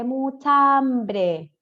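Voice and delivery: "mucha hambre" very slow, the vowels fused and stretched; words joined slowly. Shown as a demonstration. Teacher shows students how mucho and hambre join, slow demonstration. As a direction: draw out "mucha hambre" as one joined word. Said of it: "Junto el mucho con hambre".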